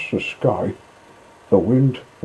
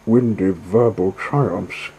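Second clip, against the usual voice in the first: ordinary delivery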